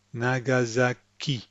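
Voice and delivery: NAGASAKI slowly, even syllables then weight on KI; even NA-GA-ZA, stressed KI in NAGASAKI. Shown as a demonstration. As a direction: say NAGASAKI French style, S as Z, landing on final KI. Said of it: "The ki is the, is where the emphasis is".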